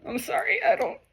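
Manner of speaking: real crying apology; sobbing apology. Real, not acted.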